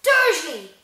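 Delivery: high, booming call of the name; booming shout. A narrator's reading. Hagrid about to explode at Uncle Vernon, booming. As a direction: boom the surname in fury. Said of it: "he boomed".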